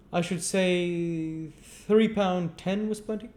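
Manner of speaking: high, slow lofty offer; name the price with condescension; pompous chairman. Acted. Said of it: "said Mister Limbkins".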